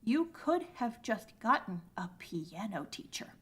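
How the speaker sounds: slow, hushed near-whisper; exasperated whisper. Pretend. Scolding the music student in the anime, exasperated whisper. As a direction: whisper it slowly, spelling out the obvious.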